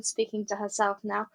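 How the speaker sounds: ordinary delivery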